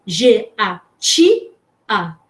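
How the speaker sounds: "G A T A" as separate sounds, the T said "ti"; spelled out. Shown as a demonstration. Teacher showing what the child wrote, "G A T A" spelled out. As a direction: sound out "G A T A" piece by piece, pausing between. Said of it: "ali ela colocou".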